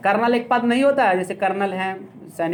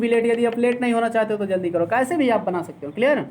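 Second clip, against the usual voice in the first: ordinary delivery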